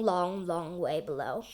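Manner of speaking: ordinary delivery, nothing marked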